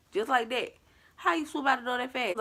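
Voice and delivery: high-pitched, baffled questioning; incredulous. Real, not acted. Questioning how the figure flew by, incredulous. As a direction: lift the pitch in sheer disbelief. Disbelief at impossibly fast figure.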